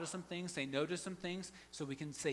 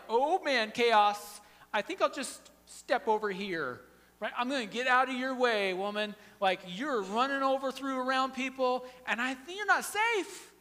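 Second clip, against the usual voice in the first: loud, higher mock exasperation backing away; annoyed bystander; 8 semitones higher, 9 dB louder than usual. Bystander mocks busy Martha, loud and exasperated. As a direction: ham up a bystander fleeing the chaos.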